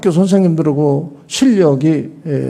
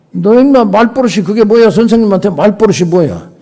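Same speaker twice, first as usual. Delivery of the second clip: indignant teacher, pitched up and louder; acted angry rebuke; 6 semitones higher, 5 dB louder than usual. Teacher to student who talked back, acted angry rebuke. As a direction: scold a cheeky kid, voice raised.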